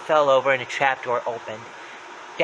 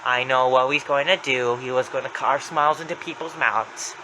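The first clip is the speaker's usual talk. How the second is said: ordinary delivery, nothing marked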